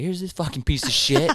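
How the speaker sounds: laughing jab at the boss; mocking, laughing. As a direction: sneer it out through laughter. Mocking the manager in kitchen.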